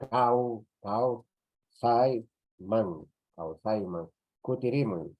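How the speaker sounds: kau, sai, man chopped into separate beats; teacher spelling out each syllable. Shown as a demonstration. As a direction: dictate kau sai man slowly for writing. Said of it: "Elimino ese diptongo con la wa".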